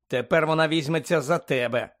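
ordinary delivery

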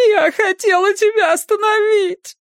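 high, loud wailing sob; sobbing. A narrator's reading. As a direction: cry it out in despair. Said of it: "девица зарыдала в голос".

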